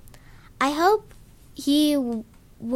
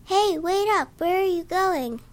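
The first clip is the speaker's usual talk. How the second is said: put-on higher, calling out as a small child; high kid calling; 8 semitones higher than usual. A narrator's reading. Kid calling after the narrator, high and eager.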